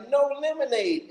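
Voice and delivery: high-pitched mock scream without extra volume; half-hearted retold screech. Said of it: "she was screaming like".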